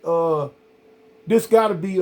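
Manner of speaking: ordinary delivery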